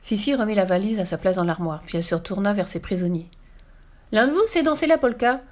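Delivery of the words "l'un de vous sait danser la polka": put-on high, eager child's question; little girl. A narrator's reading. Girl asking her prisoners about polka, high child voice. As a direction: ask keenly in a much higher voice.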